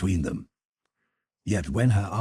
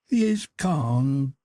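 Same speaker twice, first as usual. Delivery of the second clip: sad murmur; 10 semitones higher, 6 dB louder, much slower than usual. Acted. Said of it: "murmured Sybil sadly".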